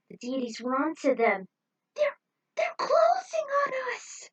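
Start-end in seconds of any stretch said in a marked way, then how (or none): 1.9-4.3 high, loud panicked shriek; crying out in terror; stammering panic as titans close in; terrified cry. Acted. Deities marching at the group.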